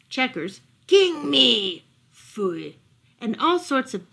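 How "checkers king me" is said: plain reading voice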